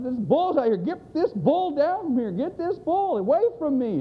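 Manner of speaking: hammed-up urgent cry from up a tree; mock panic. Man in tree cries for help.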